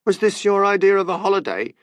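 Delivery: furious English tourist yelling; yelled. A narrator's reading. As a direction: shout it in a holiday row. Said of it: "yelled the woman".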